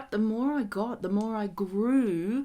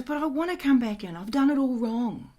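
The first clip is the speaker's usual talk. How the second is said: higher, louder retold plea; protesting dad; 5 semitones higher, 5 dB louder than usual. Dying dad begging to come back in, mildly protesting. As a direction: lightly voice a dad pleading his case.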